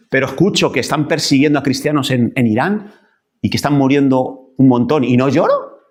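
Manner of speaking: usual speaking voice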